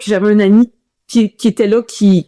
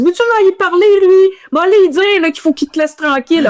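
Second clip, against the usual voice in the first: much higher, eager voice egging her on; teasing Québécois runner; 9 semitones higher than usual. Retold or imitated. A fellow Québécois teases her about the American runner, high mock ribbing voice. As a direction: pitch way up like a buddy ribbing her.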